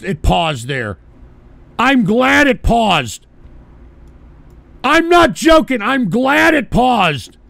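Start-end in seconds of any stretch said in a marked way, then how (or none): 1.8-7.4 loud, slow, drawn-out declaration; exasperated, loud and stretched out; emphatic insistence. Real, not acted. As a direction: hammer each word home, raised voice. Insisting loudly after clip paused.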